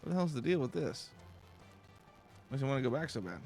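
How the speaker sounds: usual speaking voice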